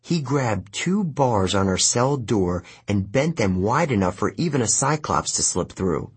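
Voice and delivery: plain reading voice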